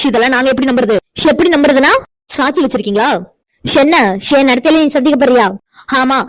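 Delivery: ordinary delivery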